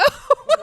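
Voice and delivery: high loud laugh; laughing at the punchline; burst of laughter. Real, not acted. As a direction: let out a big laugh. End of funny story.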